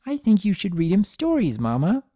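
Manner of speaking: put-on high, curious child voice; eager little girl; little filly Sunny. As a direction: play the small filly asking her mother.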